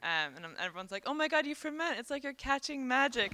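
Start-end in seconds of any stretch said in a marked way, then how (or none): 1.1-3.3 high-pitched imitation of excited fans; playful, higher-voiced mimicry of delighted people; mock excited gushing. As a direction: gush like an amazed admirer, hammed-up. Acting out people's delighted reaction.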